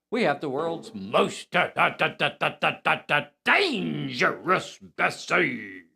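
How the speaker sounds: stuttered drumroll build-up, then a stretched-out name; show announcer. Acted. Host introducing Bessie, hammed-up announcer build-up. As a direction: announce the star with a mock drumroll.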